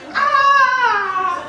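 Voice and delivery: loud, high fangirl scream; thrilled scream. Acted. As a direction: scream with giddy joy. Thrilled that crush knows her name.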